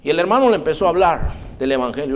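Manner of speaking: ordinary talk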